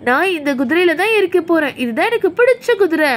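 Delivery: normal talking voice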